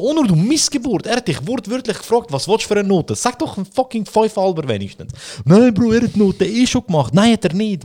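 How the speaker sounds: a student scolding his partner over a bad grade; mock outrage. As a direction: yell at a hopeless teammate, half laughing. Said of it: "Ich so".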